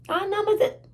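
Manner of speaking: high, loud-edged mock child protest; whining child. Child protesting to mother over gloss, imitated high whine. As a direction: imitate a kid objecting to a no.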